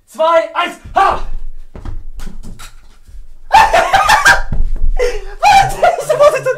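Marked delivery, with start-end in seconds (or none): 1.2-5.4 high, breathless victory cackle; high breathy triumphant laugh. Real, not acted. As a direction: laugh out in a high voice after winning. Triumphlachen nach dem Sieg. (hohes, triumphierendes Lachen)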